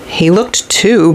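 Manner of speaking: usual speaking voice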